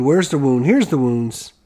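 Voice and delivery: ordinary talk, nothing marked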